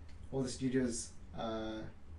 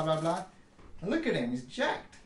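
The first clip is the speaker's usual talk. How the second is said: ordinary talk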